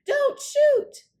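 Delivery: high, loud panicked yell; frightened shout. A narrator's reading. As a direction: scream it to stop her. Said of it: "Cindy yelled".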